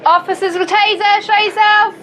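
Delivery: high, carrying call into rooms. Real, not acted. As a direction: call out a warning. Officer calls into searched house.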